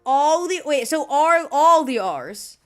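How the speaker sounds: loud, incredulous outburst; loud dismay. Real, not acted. Learning that all the R's are rolled, loud dismay. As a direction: raise your voice in disbelief.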